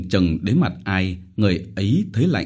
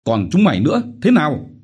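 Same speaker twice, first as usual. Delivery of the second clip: louder, higher menacing question; threatening demand; 8 semitones higher, 5 dB louder, faster than usual. Acted. Chief threatening the silent villagers, menacing shout. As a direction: threaten the whole crowd. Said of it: "hỏi mọi người".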